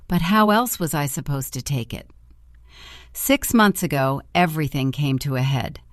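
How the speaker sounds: ordinary delivery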